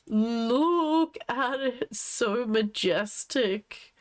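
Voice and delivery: slow, high, drawn-out awe; gushing admiration. Real, not acted. Streamer cooing over a husky, gushing in slow awe. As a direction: coo it slowly, stretching every word.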